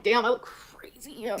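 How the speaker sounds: slow breathy whisper to self. Retold or imitated. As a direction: mutter it under your breath. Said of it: "I'm like".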